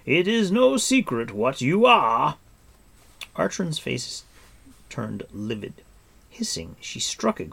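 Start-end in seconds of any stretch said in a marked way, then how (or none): 0.0-2.4 loud, high, drawn-out defiance; ringing declaration of contempt; scornful cry. A narrator's reading. Captive lord defying the queen, crying out scornfully. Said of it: "cried Gwydion".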